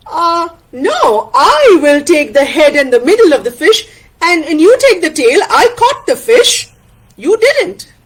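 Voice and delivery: indignant friend standing firm; Tana, indignant. Acted. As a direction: insist on your share, rising as you go.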